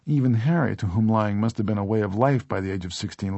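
ordinary delivery

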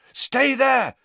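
high, loud shout over the wind; urgent shouted warning. Acted. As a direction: yell it to carry over a gale. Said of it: "he shouted at the others".

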